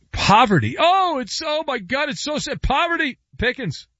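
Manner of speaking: high, mocking sob-story voice; mock pity. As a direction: pretend to weep over his pay. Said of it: "many people crying".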